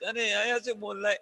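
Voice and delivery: normal talking voice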